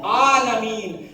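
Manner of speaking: عالمین slowly, with the throaty ع sound clearly voiced; with a clear ain. Shown as a demonstration. Teacher shows students the ain in a slow careful عالمین. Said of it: "عین کی آواز نکالیں گے۔".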